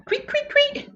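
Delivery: high, soft mock chirping of crickets. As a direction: chirp like crickets in a silent room. Imitating crickets.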